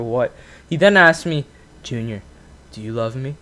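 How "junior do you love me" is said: imitating a wounded dad, low and very soft; hushed, low, dejected plea; sad voice. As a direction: ask quietly, as if close to tears. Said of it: "in the sad voice, like he's about to cry".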